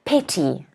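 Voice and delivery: petty, soft with PET stressed. Shown as a demonstration. Teacher models petty again, stressed first syllable. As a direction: land on PET in petty, quietly. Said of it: "Stress again on the first syllable".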